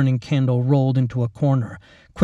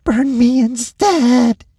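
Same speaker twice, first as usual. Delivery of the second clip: much higher, louder, slow pleading; desperate plea; 11 semitones higher, 7 dB louder, slower than usual. A narrator's reading. Lacey begging the orderly to burn her instead, slow and desperate. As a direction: drag out the begging. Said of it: "she hissed".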